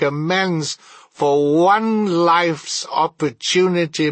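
high, loud, drawn-out spectral wail; lamenting ghost. Acted. The ghost laments a misused life to Scrooge, anguished wail. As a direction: cry out in anguished regret, stretching the last words.

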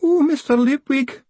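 high wailing cry; distraught high wail. Acted. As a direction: wail his name again. Wailing woman clings to him.

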